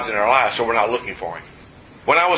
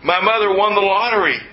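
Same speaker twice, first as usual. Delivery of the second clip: imitating an overjoyed woman in a higher voice; excited employee; 6 semitones higher than usual. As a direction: act out her happy outburst, pitched up. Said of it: "She's so excited".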